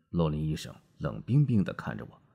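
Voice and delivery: ordinary delivery, nothing marked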